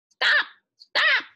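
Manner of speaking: very high, loud bird screech, slowing at the end; a mynah yelling from the papaya tree. Acted. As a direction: shriek it like a noisy bird. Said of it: "screeched the noisy mynah bird".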